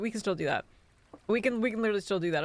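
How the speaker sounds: ordinary delivery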